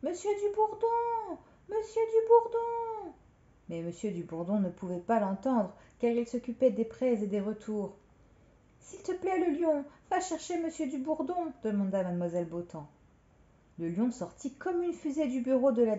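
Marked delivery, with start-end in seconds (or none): 0.0-3.1 high, drawn-out call; calling for help. Acted. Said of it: "elle appela à l'aide". 8.8-11.5 high-pitched, urgent plea; pleading request. Acted. Injured director begs lion for help.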